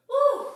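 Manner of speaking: high, loud "woo"; loud puffed-out woo; exerted whoop. Real, not acted. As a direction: let out a sudden high whoop of effort. Worn out by the workout.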